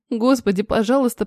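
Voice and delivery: usual speaking voice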